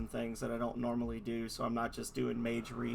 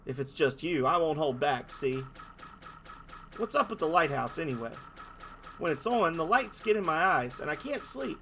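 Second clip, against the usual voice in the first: tough guy voice. Acted. Dolphin threatening the heroes in a thug voice. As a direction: lean in like a cheap movie thug.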